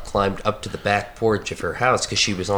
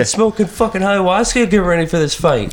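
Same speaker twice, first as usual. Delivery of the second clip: higher, loud bragging bro voice; mock Joe Rogan; 9 semitones higher, 4 dB louder than usual. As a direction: brag like a hyped fighter. Mocking Rogan fight talk.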